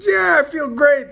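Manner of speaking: high, loud, drawn-out mock cheer; hammed-up eager reassurance; fake enthusiasm. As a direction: oversell feeling great to fool them. Lying brightly to the doctors.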